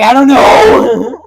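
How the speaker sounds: high, loud, slow clueless drawl; drunk clueless self. Retold or imitated. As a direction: play your drunk, lost self. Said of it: "I'm like".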